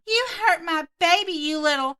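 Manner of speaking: hammed-up furious mom; entitled Karen yelling.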